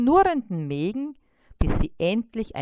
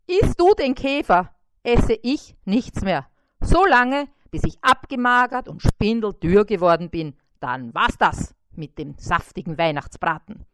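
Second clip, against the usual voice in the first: threatening fly; 8 dB louder than usual. A narrator's reading. Fly threatening to starve unless the beetle is spared, loud firm. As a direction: lay down the ultimatum. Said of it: "drohte Bissi".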